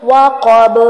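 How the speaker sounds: وقب with a heavy qaf and echoing final ba; وقب with qalqala; bouncing ba. Shown as a demonstration. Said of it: "با کو پھر ہلائیں گے".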